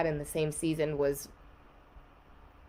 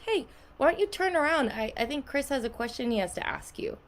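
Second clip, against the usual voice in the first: higher, sly teasing hint; sister hinting; 8 semitones higher than usual. Retold or imitated. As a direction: tease her to turn around. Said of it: "My sister goes".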